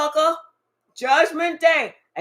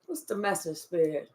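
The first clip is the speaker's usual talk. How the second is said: hushed question to the spirit; 8 semitones lower, 7 dB quieter than usual. Real, not acted. Asking the spirit during a reading.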